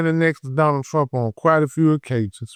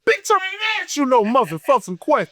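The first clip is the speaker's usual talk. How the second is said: raised, heated retelling of the snap at the heckler; retold angry clapback; 10 semitones higher than usual. Telling the heckler off again, heated and raised. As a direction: fire it back like you're reliving the argument.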